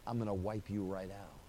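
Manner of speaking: a hint of divine doom, spoken low; ominous quiet God. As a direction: sink to a dark, soft threat. God's verdict voiced.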